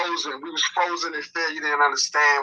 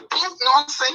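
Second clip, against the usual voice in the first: high mock boop; playful sound effect of a whack; bottle hit sound effect; 11 semitones higher than usual. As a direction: pop it out like a hit landing.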